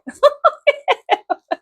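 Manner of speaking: squeaky high-pitched giggle. Real, not acted. Laughing at the G-string in the box, high giggle.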